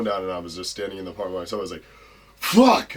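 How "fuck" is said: retold high, loud shocked yell; shocked outburst. Newly signed wrestler alone after the call, shocked yell. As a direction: burst out with a loud, high-pitched curse.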